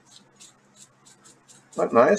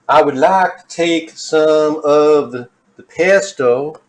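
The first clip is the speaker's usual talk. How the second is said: impersonating that woman; 2 semitones higher than usual. Retold or imitated. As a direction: purr the cooking steps like an eerie villain. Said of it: "I just love impersonating that woman".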